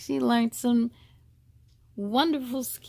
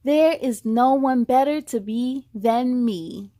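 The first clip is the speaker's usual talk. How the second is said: affirmations to repeat; 6 dB louder than usual. Shown as a demonstration. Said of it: "you could repeat after me".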